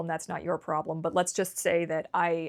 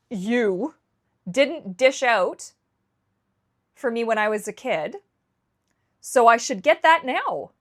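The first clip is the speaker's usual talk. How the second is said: louder and higher, fed-up protest; frustrated outburst; 5 semitones higher, 7 dB louder than usual. Retold or imitated. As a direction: vent it like years of anger spilling out. Angry rant in her own head.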